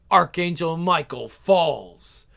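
usual speaking voice

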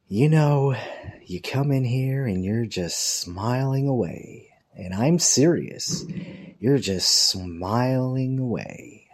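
drawn-out, mocking secretary impression; sarcastic secretary. Secretary needles a smiling coworker, sarcastic. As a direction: imitate her slowly, stretching the words sarcastically. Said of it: "just this sarcastic look on her face".

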